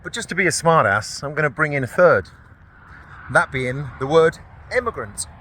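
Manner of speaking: normal talking voice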